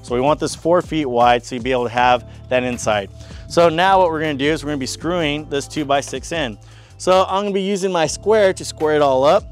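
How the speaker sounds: ordinary delivery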